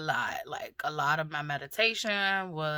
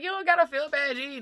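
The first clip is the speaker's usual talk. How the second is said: high, loud retold brush-off of an apology; emphatic reassurance; 11 semitones higher, 4 dB louder than usual. Mother telling daughter not to feel bad, emphatic reassurance. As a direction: wave the apology away, pitched up.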